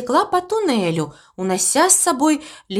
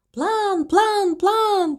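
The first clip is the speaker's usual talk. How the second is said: echo; 10 semitones higher, much slower than usual. A narrator's reading. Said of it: "подхватило эхо".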